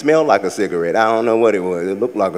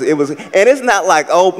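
ordinary talk

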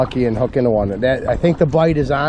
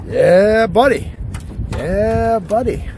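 excited cheer; 7 semitones higher, much slower than usual. Real, not acted. Fishing buddy cheering a released smallmouth, excited high whoop. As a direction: cheer the fish on as it swims off.

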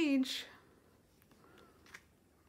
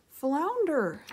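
loud, higher call across the water; 5 semitones higher, 6 dB louder than usual. Acted. Ariel calling to her falling friend Flounder, alarmed. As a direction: call to your sinking friend. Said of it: "she called".

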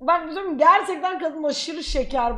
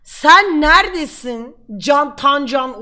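slow, loud calling out; 9 dB louder, slower than usual. Real, not acted. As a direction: shout it out across the room. Calling out to missing friend.

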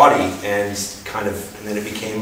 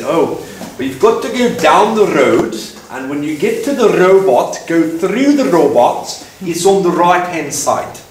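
put-on higher, louder South African accent; South African hostel lady; 6 semitones higher, 4 dB louder than usual. Hostel lady gives directions to the pharmacy, South African accent. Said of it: "and the lady said".

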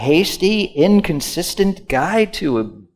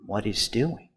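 usual speaking voice

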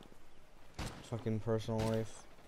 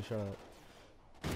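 harsh whispered shush; whispering; 2 semitones lower, 10 dB quieter than usual. Real, not acted. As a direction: hiss it under your breath. Hushed jab at himself.

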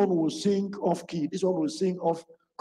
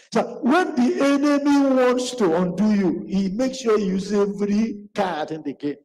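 louder, higher preaching push; forceful preaching; 4 semitones higher, 4 dB louder than usual. Acted. Preacher warning the church about the enemy. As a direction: drive the warning home to the congregation.

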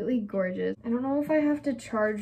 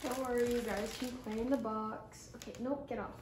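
softer, coaxing pet voice; talking to the cats; 8 dB quieter than usual. Real, not acted. Owner telling her cats to get off, softly coaxing. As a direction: sweet-talk the cats off the box.

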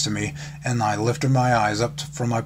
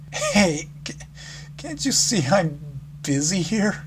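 higher, wavering, trying to sound fine; semi-stable voice; 7 semitones higher than usual. Acted. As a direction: cover up the crying with a higher voice. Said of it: "I asked in a semi-stable voice".

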